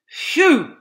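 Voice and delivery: high, loud relieved sigh; relieved phew. Acted. Fox relieved after digging, loud phew. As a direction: blow out in relief. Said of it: "said Mister Fox".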